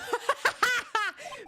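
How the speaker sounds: loud, high-pitched laughing fit; burst of laughter. Real, not acted. Gamer cracks up over a bird, laughing hard.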